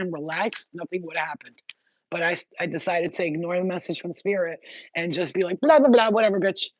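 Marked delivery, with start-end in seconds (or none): 5.6-6.7 loud, high, brushing-off blah blah; a bratty, loud "whatever"; dismissive mockery. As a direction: wave the advice away with scorn. Mocking spirit's advice, dismissive.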